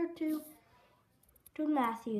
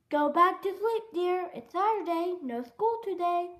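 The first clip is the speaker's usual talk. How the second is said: put-on higher-pitched mother addressing her child; mother's voice; 5 semitones higher, 4 dB louder than usual. Mother sending Froggy back to bed, higher motherly voice. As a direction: raise the pitch as a storybook mom.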